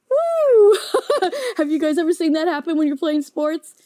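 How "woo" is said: high loud whoop into laughter; laughing whoop. Real, not acted. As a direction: let out a big laughing woo. Laughing exclaim after kid voice.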